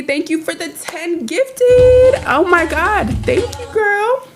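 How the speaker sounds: squeaky grateful thank-you; high cute thanks. Real, not acted. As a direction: gush it up high and sweet. Thanking for ten gifted subs.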